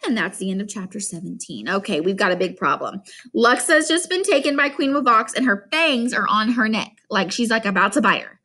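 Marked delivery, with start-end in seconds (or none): none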